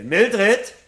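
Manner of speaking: loud, high call through the house. Acted. Montag calls loudly through the house for his wife. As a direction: shout for her from far away.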